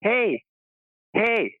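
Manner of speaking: retold calling out. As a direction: imitate hailing someone, without extra volume. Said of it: "he calls out to the guy".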